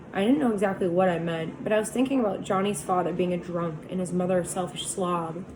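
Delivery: usual speaking voice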